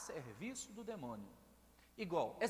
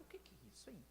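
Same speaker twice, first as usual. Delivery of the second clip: hushed whisper of disbelief; preacher watching the possessed girl, whispering in awe; whispered wonder; 15 dB quieter, faster than usual. Retold or imitated. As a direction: breathe it out in amazed secrecy. Preacher whispers his own reaction.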